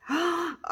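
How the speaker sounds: sharp high gasp of delight; surprised gasp. Real, not acted. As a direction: gasp as the piece is revealed. Unveiling finished resin piece.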